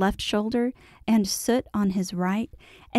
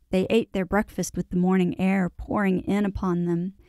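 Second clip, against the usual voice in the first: plain reading voice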